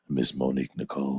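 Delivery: deep, smooth radio DJ intro. Shown as a demonstration. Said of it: "Watch the voice change".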